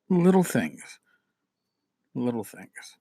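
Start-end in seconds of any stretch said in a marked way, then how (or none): 2.1-3.0 much softer, almost breathed repeat; soft echo. Shown as a demonstration. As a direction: let it fall away to a hush. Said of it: "repeated softer".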